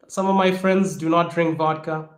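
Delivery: ordinary delivery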